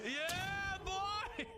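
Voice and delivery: high gleeful whoop; triumphant cheer. Real, not acted. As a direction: cheer the win at the top of your voice. Celebrating a kill.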